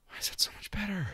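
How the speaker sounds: breathy hushed whisper. Real, not acted. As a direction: drop to a secret whisper. Whispered aside after the character bit.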